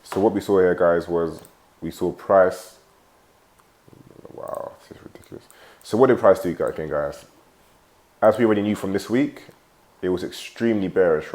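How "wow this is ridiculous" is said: exasperated whisper under the breath; whispered annoyed mutter. Real, not acted. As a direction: mutter it to yourself, fed up. Annoyed by notifications mid-lesson.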